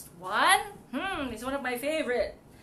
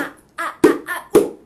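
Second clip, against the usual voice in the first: monkey noises; 2 semitones higher, 13 dB louder, faster than usual. Acted. Loud monkey calls.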